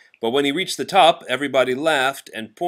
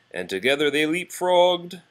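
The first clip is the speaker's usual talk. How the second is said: plain reading voice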